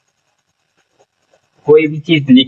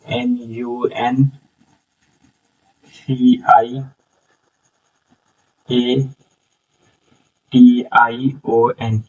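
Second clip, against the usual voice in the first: slow letter-by-letter dictation with long pauses; spelling letter by letter; 2 semitones lower, 5 dB quieter, much slower than usual. Shown as a demonstration. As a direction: spell it out slowly for someone writing it down. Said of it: "ये pronunciation.". (एक-एक अक्षर धीरे-धीरे बोलते हुए)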